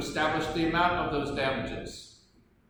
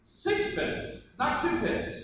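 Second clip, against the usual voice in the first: feigned surprise; 11 semitones higher, much slower than usual. Acted. Lawyer feigns surprise at price.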